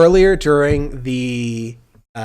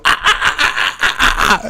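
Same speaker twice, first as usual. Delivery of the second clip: breathy staccato laugh imitation; imitated smug laugh; 10 semitones higher, 5 dB louder than usual. Imitating the debater's smug laugh in the video. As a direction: mimic a smug asshole laugh, ah ah ah. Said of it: "she would just go".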